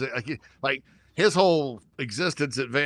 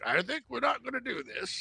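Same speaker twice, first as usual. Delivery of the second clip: quoting App; 10 semitones higher, 7 dB quieter than usual. Retold or imitated. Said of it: "App shows up and it's like".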